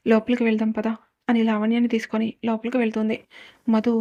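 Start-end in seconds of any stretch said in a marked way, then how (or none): none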